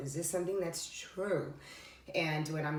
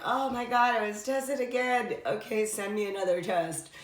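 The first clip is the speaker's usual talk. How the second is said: high, louder mock-exasperated outburst; exasperated laughing realization; 5 semitones higher, 4 dB louder than usual. Retelling her own realization to listeners, high playful exasperation. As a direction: throw up your hands and dare the universe. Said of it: "And I was like".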